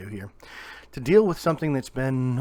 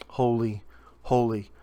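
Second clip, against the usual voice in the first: separate, weighty words building louder to the last; angels' proclamation; 5 dB louder than usual. Retold or imitated. Preacher echoing what the angels say, solemn proclamation. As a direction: let the final word ring out loud. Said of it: "the angels say".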